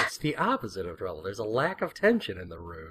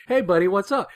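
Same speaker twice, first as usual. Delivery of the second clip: cheery greeting; 9 semitones higher, 4 dB louder than usual. Retold or imitated. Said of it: "He's like".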